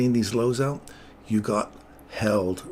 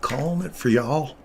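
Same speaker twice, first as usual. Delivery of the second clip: put-on light southern accent; 5 semitones higher, 3 dB louder than usual. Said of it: "That's my southern accent".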